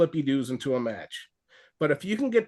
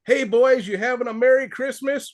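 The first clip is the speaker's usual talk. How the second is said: higher, louder wrestler's gleeful taunt; Repo Man greeting; 6 semitones higher, 4 dB louder than usual. Retold or imitated. Wrestler taunting his opponent while greeting fans, gleeful. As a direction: greet the fans like a cocky heel. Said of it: "He goes".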